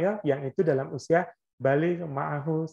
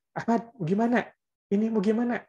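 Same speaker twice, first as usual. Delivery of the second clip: higher, brisk parent's call to Ahmad; parent calling by name; 6 semitones higher than usual. Retold or imitated. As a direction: call the child by name, a little impatient. (orang tua memanggil Ahmad, nada tinggi)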